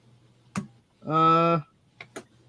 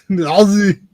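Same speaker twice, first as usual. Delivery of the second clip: put-on Australian accent; 5 dB louder than usual. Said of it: "my Australian imitation was bad".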